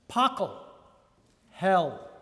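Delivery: ordinary delivery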